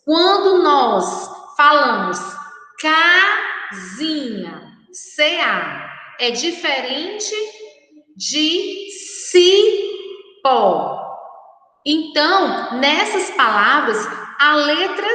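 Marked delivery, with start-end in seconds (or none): 2.8-4.7 "casinha" slowly, long CA with a K sound; "casinha" split, first syllable stretched; showing the sound. Shown as a demonstration. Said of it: "Então, observem os sons". 9.3-11.0 "cipó" split, high CI with an S sound; "cipó" with CI stretched and higher; showing the sound. Shown as a demonstration. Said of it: "Então, observem os sons".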